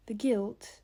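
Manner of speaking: understated crack of grief. A narrator's reading. Adeline struggling to speak of the guilt, voice cracking. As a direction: let the voice falter slightly. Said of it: "Adeline's voice cracked".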